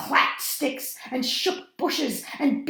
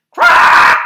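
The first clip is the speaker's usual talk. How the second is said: loud raucous; 20 dB louder than usual. A narrator's reading. Parrot's raucous morning call.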